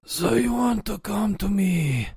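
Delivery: very slow, strained high mob-boss voice; hammed-up crime boss, high then dropping at the end; Godfather. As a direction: drag out every word like an Italian don. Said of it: "Oh, do a God-, Godfather".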